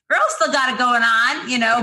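high, sassy brag to herself; sassy self-hype. Retold or imitated. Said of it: "I was like".

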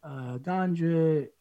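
drawn-out, hesitant mock sales pitch; half-hearted lifeguard impression, words stretched out; imitated Jamaican lifeguard. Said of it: "I'm not gonna do a Jamaican accent".

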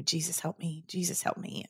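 very soft retold plea; hushed prayer. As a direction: pray it under your breath. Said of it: "just praying".

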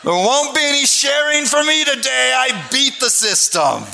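high, loud mock-triumphant kid; gloating child. Preacher voicing the boy who beat the system, triumphant. Voicing the victorious boy's thoughts.

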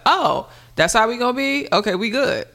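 high, clipped-off sarcastic dismissal; mock a cool "fine then" brush-off; imitating mother cutting off a friend, sassy and high; sassy cold shrug-off. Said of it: "it's just like".

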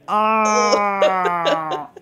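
long, high drawn-out "ahh"; slow-motion wail, mimicking shock; imitated slow-mo cry. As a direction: stretch out a high mock cry. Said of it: "Nastasia was like".

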